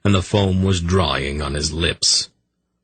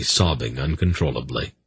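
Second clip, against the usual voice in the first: plain reading voice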